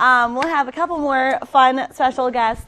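loud TV host read; telethon host. Acted. Hosting a mock telethon for the camera, loud and bright. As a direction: sell it like a telethon anchor.

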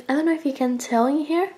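ordinary talk, nothing marked

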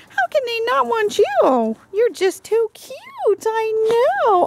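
high, sing-song cooing at a dog; baby talk to a puppy. Acted. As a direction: gush over the sweet puppy. Cooing at puppies.

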